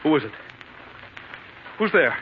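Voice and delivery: usual speaking voice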